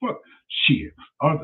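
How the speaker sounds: usual speaking voice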